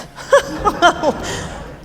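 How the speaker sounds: short airy chuckle; an amused little laugh; breathy laugh. Acted. Mother laughs at potpourri joke.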